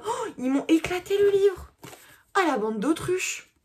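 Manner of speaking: sudden high outcry; dismayed outcry. Real, not acted. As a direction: cry out at the damage. Outcry at damaged book.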